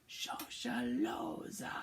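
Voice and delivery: slow, whispered Shosholoza; whispered answer. Shown as a demonstration. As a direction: whisper it as the pupil's turn. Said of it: "You try it".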